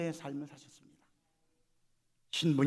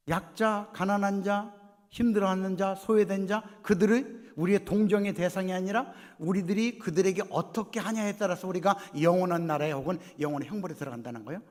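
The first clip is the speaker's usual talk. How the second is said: loud, raised-pitch declamation; emphatic preaching; 6 semitones higher, 7 dB louder than usual. Acted. Preacher warning the congregation about the weak, forceful and loud. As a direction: hammer out the list, then press the warning.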